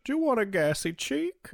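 silly high-pitched offer; mock film heroine. Continues the Lecter film bit.

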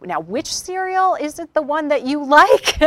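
high, loud, laughing through the words; amused mock-helpful question. Roommate asking about the cereal, laughing and high.

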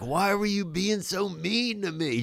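high, slow, wounded whine; whiny hurt plea. Retold or imitated. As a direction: sound pathetically hurt, like a kid. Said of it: "the fucking lamest thing you can say".

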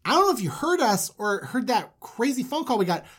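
high, emphatic pleading with dad; pleading sons. Acted. Sons pleading with their father.

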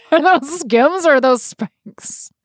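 hammed-up airport security questioning; TSA agent voice. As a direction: grill the traveler like a pretend screener. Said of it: "We have to speak in the T S A voice".

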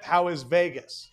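usual speaking voice

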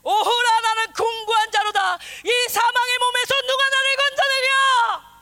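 slow, high, shouted lament; Paul's anguished cry. Retold or imitated. Preacher voicing Paul's despairing confession as a slow, high shout. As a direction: cry out like a tormented apostle. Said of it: "바울이 뭐라고 고백하냐면".

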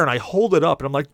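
normal talking voice

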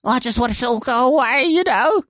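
mock the upset mother with a failed Australian accent, high up; bad Australian accent. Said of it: "I can't do an Australian accent right now".

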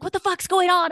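very high urgent yell; imitated furious mother, pitch way up; alarmed demand. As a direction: charge into the room demanding answers. Mother storms in with gun, acted.

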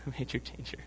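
quick, soft repeat through a laugh. Real, not acted. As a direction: giggle through the repeat of "A major danger". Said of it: "I'm sorry".

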